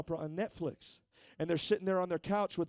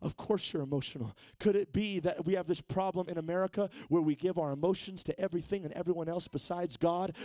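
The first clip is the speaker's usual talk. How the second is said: ordinary delivery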